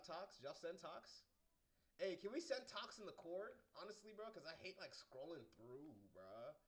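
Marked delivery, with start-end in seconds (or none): none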